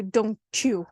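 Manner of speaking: "don't you" merged into "donchoo"; linked, ch-sound "don't you"; assimilated. Shown as a demonstration. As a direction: say DON'T YOU with a ch joining the words. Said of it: "It, it changes completely to".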